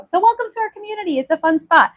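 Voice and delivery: cheery host welcome; bright sing-song welcome. Acted. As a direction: lift it up high and rattle it off. Repeated welcome, high and fast.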